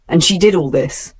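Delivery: ordinary delivery, nothing marked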